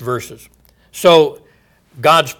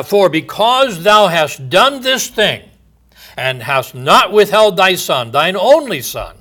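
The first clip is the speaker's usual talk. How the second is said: raised, ringing pulpit voice; solemn scripture reading; 5 semitones higher, 6 dB louder than usual. Acted. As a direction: declaim God's oath from the pulpit. Preacher reading God's words aloud.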